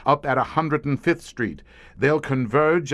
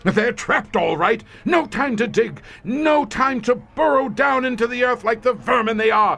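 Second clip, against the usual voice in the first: much higher, building, triumphant gloat; gloating frenzy; 9 semitones higher, 3 dB louder than usual. Acted. Commander gloating over trapped monsters, high feverish triumph. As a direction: savour every word as the trap closes.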